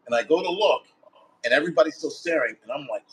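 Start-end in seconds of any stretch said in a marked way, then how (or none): none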